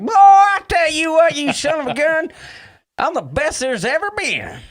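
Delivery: high, hammed-up Southern holler; Southern accent. Host mocks his own attempt at a Southern accent, high and hammed-up. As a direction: burst out loud in an exaggerated Southern twang. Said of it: "Whenever I do a Southern accent".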